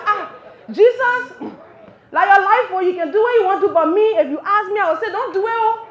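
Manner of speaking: loud, high Liberian plea to Jesus; loud pleading disciple. Retold or imitated. Disciple begging Jesus loudly not to die. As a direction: beg him loudly not to go. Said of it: "I can just see one of them like".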